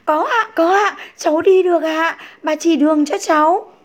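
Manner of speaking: eager answer. A narrator's reading. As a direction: jump at the chance, keen. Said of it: "nói ngay".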